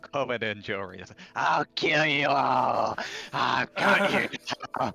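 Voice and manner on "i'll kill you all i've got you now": slow, very loud evil roar; villain roar. Acted. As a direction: bellow it like a mad villain. Player in character threatening all.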